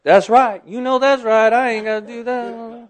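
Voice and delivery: loud, high, vindicated outburst; emphatic, self-justifying retort; triumphant agreement. Retold or imitated. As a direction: crow it back as if let off the hook. Acting out triumphant agreement with God.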